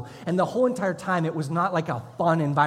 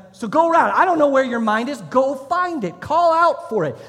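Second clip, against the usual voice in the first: drill instructor yell; 9 semitones higher, 9 dB louder than usual. Retold or imitated. Drill instructor yelling at a recruit in the chow hall, loud bellow. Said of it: "and he's like".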